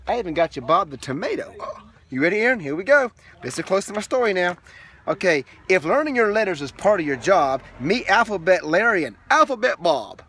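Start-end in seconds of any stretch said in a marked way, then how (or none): 5.1-10.2 lilting read-aloud rhythm; sing-song picture book reading; reading to children. Acted. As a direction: read the rhyming book to a toddler.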